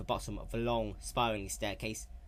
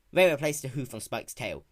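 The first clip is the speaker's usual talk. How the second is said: plain reading voice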